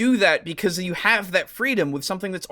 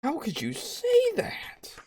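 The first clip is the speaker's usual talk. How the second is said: whispered, high mock horror; unvoiced mock-dramatic whisper; 10 semitones higher, 6 dB quieter, slower than usual. Real, not acted. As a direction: breathe it as a stunned whisper. Whispered repeat.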